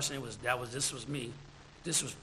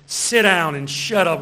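slow, loud mock order; loud command; 12 dB louder, slower than usual. Preacher announcing a rejected sermon title, loud command. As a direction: boom it out as a drawn-out command.

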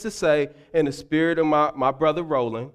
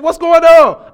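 alarmed shout; 9 semitones higher, 10 dB louder than usual. Retold or imitated. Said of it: "I said".

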